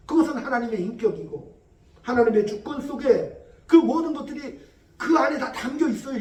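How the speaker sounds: normal talking voice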